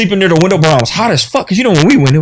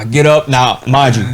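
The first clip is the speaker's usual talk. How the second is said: ordinary delivery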